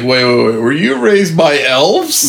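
incredulous, pitch climbing on a stretched question; hammed-up astonishment; mock disbelief. Acting player baffled by French-accented dwarf.

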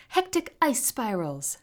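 high, loud battle cry; forceful villain shout; attack call. A narrator's reading. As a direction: announce your spell as you strike.

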